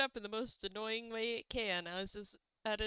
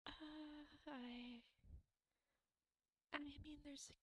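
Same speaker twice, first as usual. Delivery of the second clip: whispering; 4 semitones higher, 14 dB quieter than usual. Real, not acted. Player whispers sheepish excuse.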